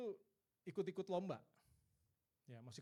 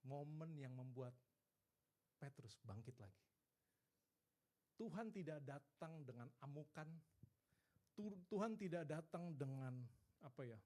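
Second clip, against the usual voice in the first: ordinary delivery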